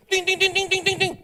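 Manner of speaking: high sing-song ting ting of a car horn; imitated horn beeps. Friend honks for Jono at gate, high sing-song horn imitation. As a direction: beep it out like a little horn.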